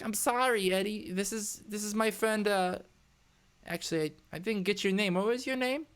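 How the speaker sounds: high, hesitant apologetic character voice; apologetic Sal, flustered. Acted. Worker introducing a stranger to her annoyed boss, high flustered apology.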